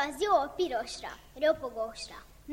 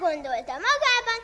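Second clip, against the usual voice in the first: ordinary delivery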